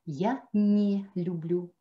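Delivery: я не люблю slowed, не held and stressed; slow я не люблю with не clearly stressed. Shown as a demonstration. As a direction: lean hard on не, slowly. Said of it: "не, N-E, carrément".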